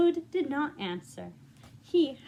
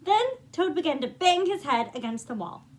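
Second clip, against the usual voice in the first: livelier, higher read-aloud as the story builds; rising storybook narration; 3 semitones higher, 5 dB louder than usual. A narrator's reading. Reading the climax to young listeners, rising excitement. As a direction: let the narration climb with the fun.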